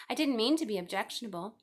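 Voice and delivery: plain reading voice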